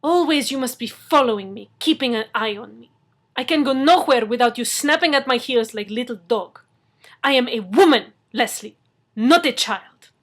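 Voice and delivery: put-on loud, furious accented woman; angry outburst. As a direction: let her anger boil over.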